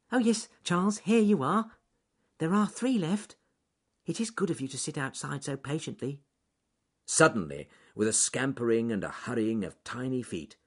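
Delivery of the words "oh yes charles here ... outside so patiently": high, polite little rabbit. A narrator's reading. Ernest handing the bear a carrot, high polite rabbit voice. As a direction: pitch it up, kind and polite.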